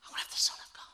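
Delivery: whispered in disbelief; stunned whisper. Retold or imitated. Mary stunned at the angel's news, whispered. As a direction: breathe it out, barely voiced, stunned. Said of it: "more of a look of".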